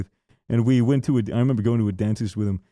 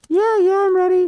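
much higher and louder, frightened yes; panicked squeak; 5 dB louder than usual. Retold or imitated. Sibling at the dentist answering the dentist in a high panicked squeak.

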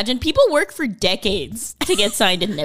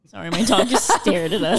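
ordinary talk, nothing marked

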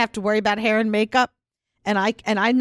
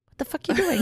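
laughing mock stare; 6 semitones higher than usual. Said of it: "I'm giving you the death stare going".

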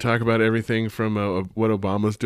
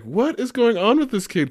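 baffled exclaim; 12 semitones higher than usual. Retold or imitated. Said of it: "And I'm just like".

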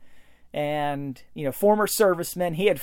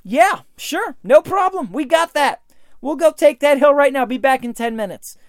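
high, loud breezy confidence; a cheerful mock Marine brag; cocky can-do; 6 semitones higher, 4 dB louder than usual. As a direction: toss it off like it's nothing.

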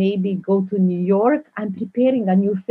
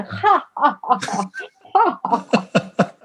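big mock guffaws, higher-pitched; imitated audience laughter; 4 semitones higher, slower than usual. Director imitating a Berlin premiere audience laughing. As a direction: laugh out "ha ha" like a roaring crowd. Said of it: "was laughing. People are".

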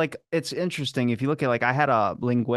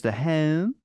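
slow Baltimore "home" with a long fronted O; Baltimore accent; 4 semitones higher than usual. Shown as a demonstration. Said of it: "Baltimore has two versions".

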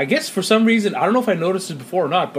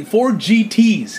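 slow emphatic; 4 semitones higher, much slower than usual. Retold or imitated. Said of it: "He said".

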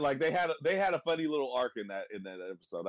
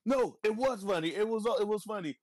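mock insistent; 7 semitones higher than usual. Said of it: "the other guy's like".

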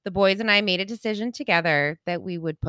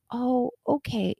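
soft, high, slow sympathy; so sweet; 4 semitones higher, 4 dB quieter, slower than usual. Retold or imitated. Young vet receptionist reacting to a grieving owner, so sweet. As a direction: imitate a sweet receptionist, drawn out gently. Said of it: "She was so sweet".

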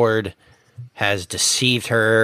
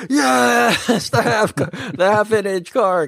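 excited yes; 13 semitones higher than usual. Retold or imitated. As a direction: squeal with delight at the screen. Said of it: "and I was like".

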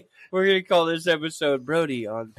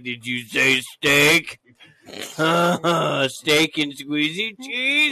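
slow drawn-out teasing voice; slow mock seductive; 4 dB louder, much slower than usual. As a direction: stretch every word out slowly. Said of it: "she's gonna be like".

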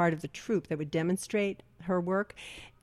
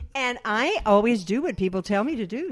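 normal talking voice

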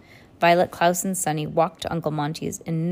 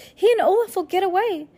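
high, urgent plea; shouting; 13 semitones higher, 3 dB louder, faster than usual. A narrator's reading. Violet to Mister Poe as villains escape, urgent shout. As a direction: protest high, desperate to be let go. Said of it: "Violet shouted".

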